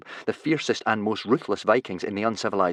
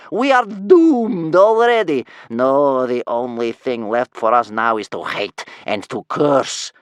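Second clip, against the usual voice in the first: loud, high wail of furious doom; scary old woman cursing bitterly; doom-laden grandmother; 4 semitones higher, 9 dB louder, slower than usual. A narrator's reading. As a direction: rage it out slowly, then drop in pitch. Grandmother keeps raging at the boy.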